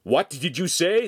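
loud, high-pitched question; angry challenge. A narrator's reading. Master confronts defiant apprentice, angry challenge. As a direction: challenge the insolence.

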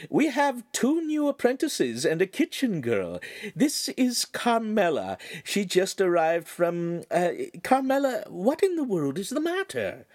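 a refined lady politely declining; signora's voice. Acted.

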